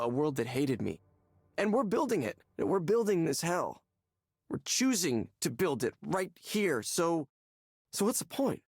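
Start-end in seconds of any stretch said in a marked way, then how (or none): 1.6-8.6 building to a desperate cry; mounting hopeless outburst ending in a high plea; rising despair. Acted.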